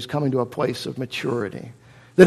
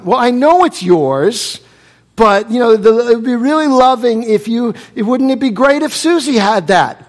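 coaxing parent; 7 semitones higher, 6 dB louder than usual. Retold or imitated. Parent coaxing child over toy.

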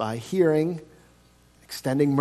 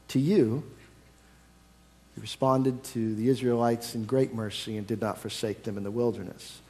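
softer, lower appeal; soft, personal; 3 semitones lower, 6 dB quieter than usual. Real, not acted. Preacher quietly confronting each listener with their own sins. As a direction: draw them in gently.

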